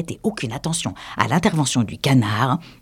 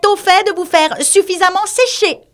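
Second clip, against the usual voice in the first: squeaky little mouse, pitched way up; high mouse voice; 3 dB louder than usual. Acted. The mouse reassuring the wet animals, high squeak. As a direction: play the fussy mouse high and thin.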